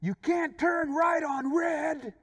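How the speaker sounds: high, loud, drawn-out yell; shouted rebuke. Retold or imitated. Other driver shouts the road rule back, shouted rebuke. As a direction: yell it slowly, every word held up high. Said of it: "he told me".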